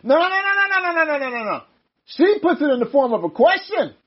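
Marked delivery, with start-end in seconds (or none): 0.0-1.6 high, rapid string of noes; urgent, high-pitched interrupting correction; insistent objection. Real, not acted. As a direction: cut in with a run of noes. Cuts in to correct the reader.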